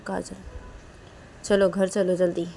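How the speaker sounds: plain reading voice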